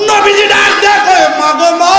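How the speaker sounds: high, loud chanted call; impassioned preaching cry. Retold or imitated. Preacher voicing the Prophet calling to the mother, high impassioned cry.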